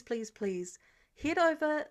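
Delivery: plain reading voice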